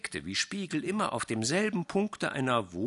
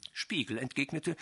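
usual speaking voice

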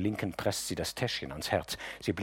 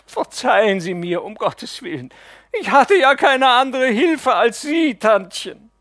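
loud, high, blubbering plea; sobbing plea; 13 semitones higher, 12 dB louder than usual. Acted. Nephew on his knees sobs to the aunt, loud and high. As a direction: beg for forgiveness through sobs. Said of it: "jämmerlich zu weinen".

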